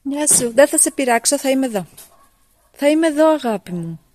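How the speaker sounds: sing-song it to the little one; high coaxing coo. Acted. Greeting the one still outside, high sweet coo.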